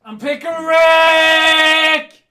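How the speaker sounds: huge high shout, last word held long; over-the-top high catchphrase bellow; ironic Pickle Rick yell. Acted. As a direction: scream it with Rick drawn way out. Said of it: "when I say "Pick a Rick," I am not serious".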